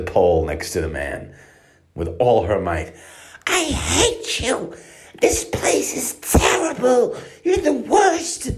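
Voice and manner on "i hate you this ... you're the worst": put-on much higher, slow, whiny toddler sobbing at the guard; crying little girl. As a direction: wail like a cross four-year-old. Four-year-old scolding the guard.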